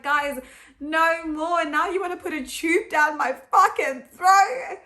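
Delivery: rising to a loud, high outraged cry; exasperated protest. Retold or imitated. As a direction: protest like you've had enough. Retelling her protest at doctors.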